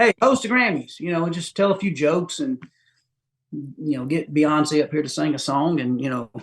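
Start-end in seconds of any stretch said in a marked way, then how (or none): 0.0-1.0 casual offer. Retold or imitated. Imagined offer to host Grammys.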